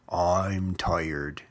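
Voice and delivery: low, lightly voiced grumpy growl; sleepy growl. A narrator's reading. Sleepy Dozer brushing off Verdi, low growl. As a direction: grumble it down low, just woken. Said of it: "Dozer growled".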